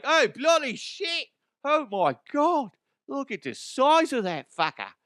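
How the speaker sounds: put-on a guy squealing in an Australian accent at a huge spider; mock Australian panic. Australian driver spotting a spider, high panicked Australian accent. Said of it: "he's like".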